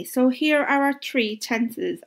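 usual speaking voice

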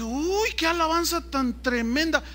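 high-pitched mock admiration; sarcastic amazement. Preacher reacting to worshippers chanting for football, sarcastic amazement. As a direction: marvel at it with pointed irony.

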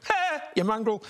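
[name] impression. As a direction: do your [name], high and cheeky. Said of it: "That's my Farnham impersonation".

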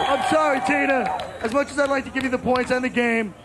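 ordinary talk

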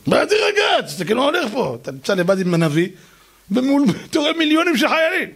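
high panicked outburst; can't believe he's told to calm down; panicked protest. Acted.

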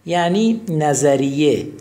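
ordinary delivery

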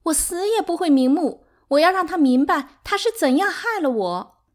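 high, loud anguished vow; 悲愤哭喊. A narrator's reading. Sixth wife vows while being restrained. (又高又响地悲愤哭喊)

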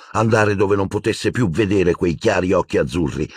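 ordinary delivery

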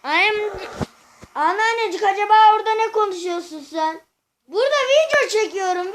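loud, high call across the house. Real, not acted. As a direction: call out to grandma in the next room. Calls to grandma from room. (yüksek sesle seslenerek)

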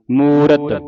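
ਮੂਰਤ long and loud, two clear syllables; full r sound. Shown as a demonstration. Teacher repeating the right reading, loud and full. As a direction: hold ਮੂ, then sound ਰਤ fully. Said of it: "ਰਾਰੇ ਦੀ ਆਵਾਜ਼ ਪੂਰੀ ਕੱਢਣੀ".

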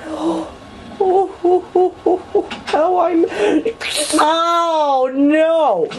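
high-pitched half-laughing whimpers; squeaky comic panic; squeaky distressed wail. Pretend. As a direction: whimper high like a cartoon mouse. Panicking in village.

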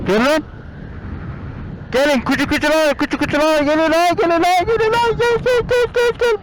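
rising high coaxing call to dogs. Real, not acted. Calling out to dogs.

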